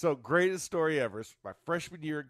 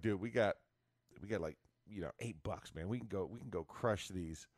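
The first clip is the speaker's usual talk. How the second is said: lower, softer dude voice; lightly voiced college teammate scheming; mock buddy voice; 6 semitones lower, 4 dB quieter than usual. Teammates pooling money in the car, low mock dude voice.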